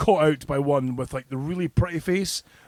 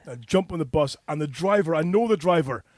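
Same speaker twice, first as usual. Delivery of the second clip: ordinary talk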